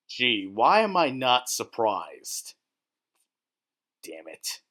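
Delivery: ordinary talk, nothing marked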